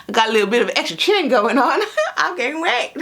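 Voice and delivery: giggling through the words; laughing. Real, not acted. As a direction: laugh while admitting it. Laughing about her extra chin.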